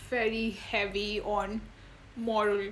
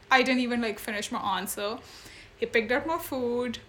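ordinary talk

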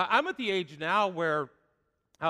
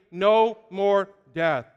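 loud, slow No more death; call to repeat; 7 dB louder, much slower than usual. Shown as a demonstration. Preacher leading congregation in unison, slow and loud. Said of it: "Say that with me".

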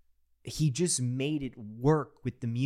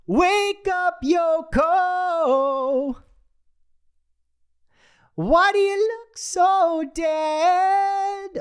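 much higher and louder, a hammed-up sung scream; mock screaming song; 10 dB louder, much slower than usual. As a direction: belt it out mockingly to wake the sleeper. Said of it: "He's screaming it to wake up [name]".

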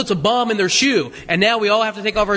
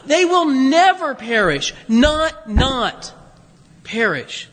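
"never" and each "not" pressed hard, slow and higher; emphatic promise; 4 semitones higher, much slower than usual. Shown as a demonstration. Preacher driving home the promise to the congregation, slow heavy stress. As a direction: hammer "never", then "not, not" before a falling "perish". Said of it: "But listen".

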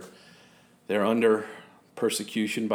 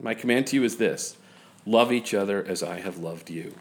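scripture reading; 4 semitones lower than usual. Acted. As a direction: read the passage gently and evenly.

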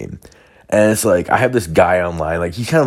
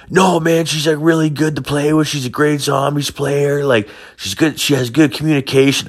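much higher, whiny defensive voice; defensive gamer buddy; 9 semitones higher than usual. Retold or imitated. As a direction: defend her eagerly, a bit whiny.

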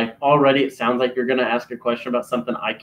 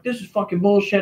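higher-pitched mock grumble; put-on complaint, pitched up; retold irate caller; 6 semitones higher than usual. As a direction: imitate an indignant customer.

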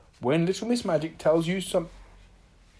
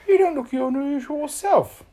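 high, louder character voice; concerned friend; 11 semitones higher, 8 dB louder than usual. A narrator's reading. Little Miss Magic greets glum Mister Happy, high concerned voice. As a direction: remark it in a raised, high voice.

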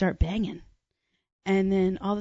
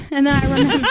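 louder and higher, rushed through a laugh; 6 semitones higher, 6 dB louder, faster than usual. Real, not acted. Storyteller resuming her safe sex point, laughing. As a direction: laugh while picking the story back up. Storyteller cracking up at own advice.

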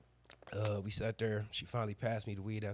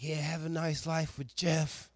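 mock woman voice; 7 semitones higher, 11 dB louder than usual. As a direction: drag out her bitter goodbye in a put-on voice. Woman's parting jab, retold.

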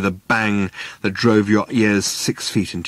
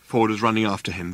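ordinary delivery, nothing marked

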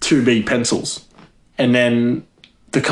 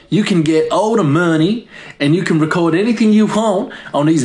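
put-on American Southern accent; 6 semitones higher than usual. Hoarder retells granddaddy's advice to psychiatrist, put-on Southern accent. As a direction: play a Southern woman quoting granddaddy, pitched up. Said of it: "it was in the south of America".

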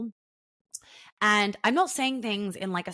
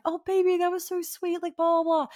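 put-on much higher, cooing oh baby; gushing sweet; 10 semitones higher, 4 dB louder than usual. Woman gushing at her fiance's sweet words, high coo. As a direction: coo it over the top like a lovesick girl.

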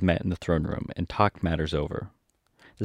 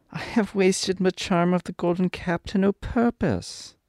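put-on high, slow little-girl lament; disappointed Dorothy; 11 semitones higher than usual. A narrator's reading. Dorothy laments the wasted charm, high disappointed voice. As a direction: lament slowly in a high girlish voice. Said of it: "almost ready to cry with disappointment".

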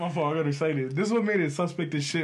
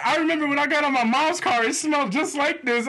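high mock girl voice; pitched up storytelling excuse; her excuse; 9 semitones higher than usual. Imitating the passenger's mom's car excuse, high. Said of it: "she was like".

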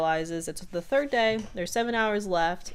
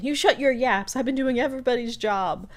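a hint of a gruff boss, louder and higher; Grunkle Stan shouting; 6 semitones higher, 4 dB louder than usual. Mabel copying the boss to scold coworkers, loud mock yell. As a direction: yell it like a grumpy great-uncle boss. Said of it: "she starts shouting like Grunkle Stan".

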